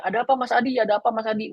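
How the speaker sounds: lightly acted onlookers asking, pitched high; orang-orang bertanya. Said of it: "nanti orang akan tanya". (menirukan warga bertanya dengan nada tinggi)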